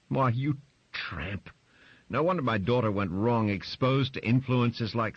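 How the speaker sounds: contemptuous insult. Acted. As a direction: spit out the insult with disgust. Grace insults Barbara.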